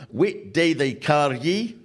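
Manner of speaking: slow, broad Ulster Scots; slow mock Ulster Scots phrasebook line; Ulster Scots reading. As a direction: read it out in thick Ulster Scots. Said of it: "Ulster Scots into English, and it goes".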